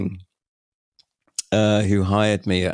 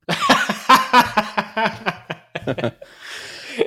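long high laugh rising; high burst of laughter; 14 semitones higher than usual. Real, not acted. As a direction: let a big high laugh out. Laughing at his own joke.

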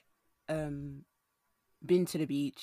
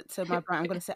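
ordinary delivery

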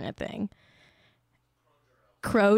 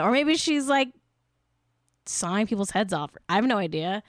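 usual speaking voice

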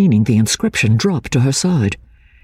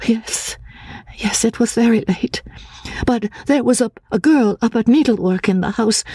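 put-on high, timid voice of a fearful girl; frightened young woman; 8 semitones higher than usual. A narrator's reading. Anne, fearing the asylum, asks the narrator in a high timid voice.

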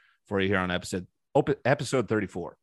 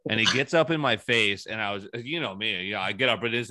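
ordinary talk, nothing marked

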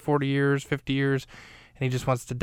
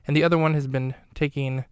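ordinary delivery

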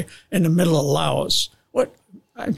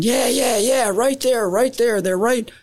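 retold excited screaming; 7 semitones higher than usual. Troops on the ground cheering the pilots' runs, retold excited screaming. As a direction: imitate urgent cheering on repeated short cries. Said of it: "I can hear the guys screaming".

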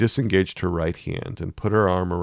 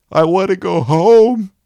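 put-on a child's wail, much higher and louder; 14 semitones higher, 12 dB louder than usual. A narrator's reading. Young inner voice wailing to go home, high loud cry. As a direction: let the inner child cry out at full pitch. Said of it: "a very young voice was crying out".

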